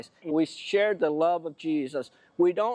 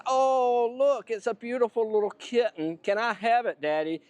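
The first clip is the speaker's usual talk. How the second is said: higher-pitched imitation of an excited daughter; pleading daughter; 6 semitones higher than usual. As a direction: beg daddy like a smitten child. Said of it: "my daughter says".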